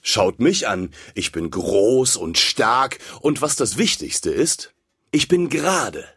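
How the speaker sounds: show-off bragging, rising on the list; boastful bully candle. Acted. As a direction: puff yourself up and brag. Red candle boasts about itself.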